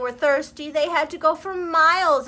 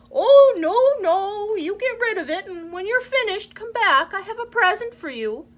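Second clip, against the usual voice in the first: much higher eager voice; grateful crocodile; 9 semitones higher, 7 dB louder than usual. Acted. Crocodile thanking the mouse, high eager voice. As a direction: refuse quickly and promise a gift.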